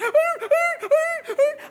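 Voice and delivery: very high, loud dog yelps; imitated dog crying. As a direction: mimic a frantic dog wailing as if dying. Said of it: "He's crying like he's dying".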